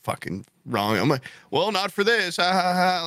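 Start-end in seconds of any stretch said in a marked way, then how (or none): 1.5-3.0 high, mock-jokey retort with fake laugh; jokester. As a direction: play the joker, pitched up. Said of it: "I'm just always the jokester".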